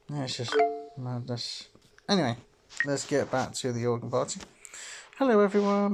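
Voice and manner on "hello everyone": calling out. Acted. Professor calls class to attention.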